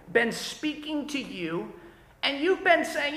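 ordinary talk, nothing marked

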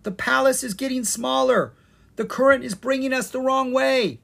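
high, loud, urgent warning; alarmed warning. A narrator's reading. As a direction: raise the alarm to your friends. Zed alerts friends to danger.

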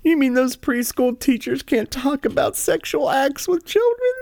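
high, whiny falsetto of mock distress; mock weepy liberal. Host mocking the spokeswoman's tears, whiny falsetto. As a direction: ham up fake outrage in a squeaky voice.

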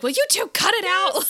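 loud, high-pitched play scolding; mock snapping. Imagining herself in that car, loud mock snapping. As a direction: burst out like a fed-up driver, hammed up. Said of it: "I might react very similarly".

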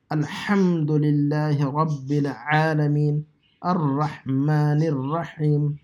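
slow "الحمدللہ رب العالمین" with pharyngeal h and ayn; measured recitation, every h in "الرحمن الرحیم" from the throat; correct recitation. Shown as a demonstration. Teacher modelling for practice, slow throaty "الرحمن الرحیم". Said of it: "صحیح تلفظ کرنا ضروری ہے".

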